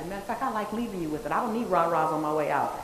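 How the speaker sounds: ordinary delivery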